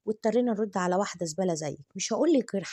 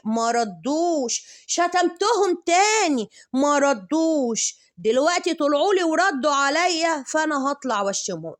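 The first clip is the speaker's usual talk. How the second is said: mock rant; 5 semitones higher, 5 dB louder than usual. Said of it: "تقول لكم إيه؟".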